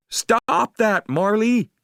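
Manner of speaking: high, loud call to the dog; cry out. Acted. Owner calling out to Marley in the water, high loud cry. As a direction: yell to stop him drinking. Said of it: "I cried".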